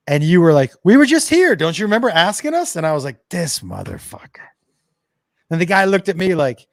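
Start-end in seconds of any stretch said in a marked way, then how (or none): 0.8-2.7 high-pitched tattletale blurting; imitated child, pitched way up; mock innocent kid. Acted kid blurting out truth. 3.3-4.5 low, soft curse under the breath; retold quiet disgust; muttered exasperation. Acted muttered exasperation at son.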